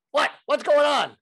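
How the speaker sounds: put-on loud, high Brooklyn accent; startled Brooklyn bark at being disturbed; Larry King. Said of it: "Even with his Brooklyn accent".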